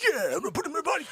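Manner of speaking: fast, high cocky echo; quick high parroting of the taunt; mock Johnny. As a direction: rattle it off like a sidekick agreeing. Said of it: "Johnny's like".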